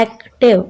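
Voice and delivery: "एक्टिव" broken in two, a clear gap between एक and टिव; split into syllables. Shown as a demonstration. Teacher showing children two parts, "एक्टिव" split at the middle. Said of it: "एक्टिव में दो parts बन रहे".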